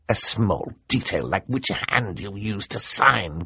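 lightly voiced scornful villain; dismissive villain. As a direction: wave away the small detail.

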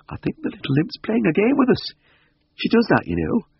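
very high, playful country voice; roguish village woman. Acted. Missus Beck excuses her hiding cat, high and roguish. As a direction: pitch it high, indulgent about the pet.

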